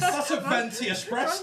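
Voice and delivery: mock posh order, laughing. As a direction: play a posh coffee snob. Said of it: "In a really posh".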